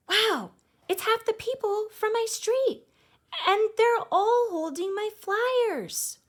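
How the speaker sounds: thrilled wow at the doorbell crowd; excited. A narrator's reading. Girl amazed neighbors came holding her flyers, excited.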